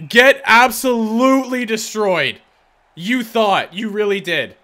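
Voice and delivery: loud, high, slowed taunt; gloating yell. Real, not acted. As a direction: rub it in their face. Gloating after huge save.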